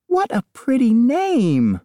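high, slow, syrupy sales voice; lightly voiced chirpy agent on speakerphone; real estate agent. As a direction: gush like an agent selling a house. Diane flattering the daughter.

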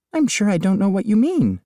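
lightly voiced chirpy agent on speakerphone; real estate agent. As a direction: gush like an agent selling a house. Diane's evasive reply.

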